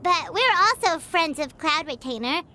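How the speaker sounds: ordinary talk, nothing marked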